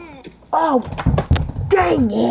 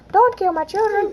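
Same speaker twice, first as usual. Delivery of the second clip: mock pleading parent; 10 semitones higher, 3 dB louder than usual. Voicing parent character pleading.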